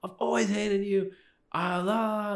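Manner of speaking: high, strained shout of hatred; retold yelling. Teen screaming at his shocked parents, high strained yell. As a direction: scream it at your parents, pitch way up. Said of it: "I'm, like, yelling at them".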